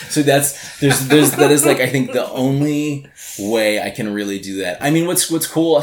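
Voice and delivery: laughing. Real, not acted. Laughing at his own tangent.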